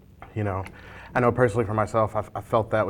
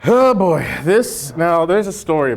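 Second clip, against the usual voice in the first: excited intro; 7 semitones higher, 8 dB louder, slower than usual. Real, not acted. Presenting the hot sauce.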